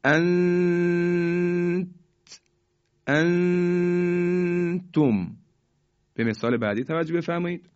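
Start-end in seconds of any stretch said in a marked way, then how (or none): 0.0-5.4 انت held in a long high hum stopping at the t, then انتم in full; ikhfa. Shown as a demonstration. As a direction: hide the n of انتم in a steady nasal hum, very slowly.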